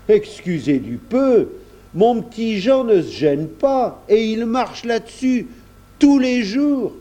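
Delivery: high, drawn-out exclamations of disbelief; scandalised countryman. A narrator's reading. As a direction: marvel indignantly as a simple farmer. Kersac scandalised by the carpet.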